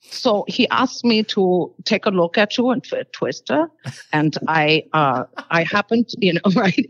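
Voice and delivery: put-on quoting the director in a light Bavarian accent. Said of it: "in his very Bavarian accent".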